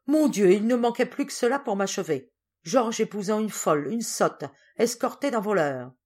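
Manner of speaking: exasperated outrage. A narrator's reading. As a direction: exclaim in appalled indignation at a son. Father's outraged outburst in acted quarrel.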